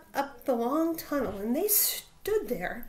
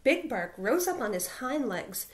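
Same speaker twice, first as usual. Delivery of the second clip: ordinary delivery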